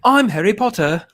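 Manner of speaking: loud, high put-on British accent; British accent attempt. Trying a British accent for James Bond, loud and high. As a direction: announce yourself in a try-hard English accent. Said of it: "That is not a James Bond accent".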